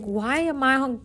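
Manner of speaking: ordinary talk, nothing marked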